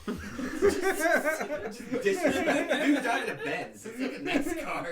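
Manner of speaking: cracking up mid-sentence; laughing. Real, not acted. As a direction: talk through helpless laughter. Laughing at the Chucky quote.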